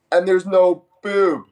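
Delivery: high-pitched, drawn-out comic alarm; slow, high mock panic; mock freak-out. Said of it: "Freaking out".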